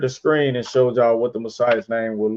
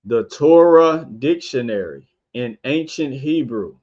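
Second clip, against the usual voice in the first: unhurried, careful title reading; 3 dB louder than usual. Shown as a demonstration. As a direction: lay out each word of the book title. Reads title twice.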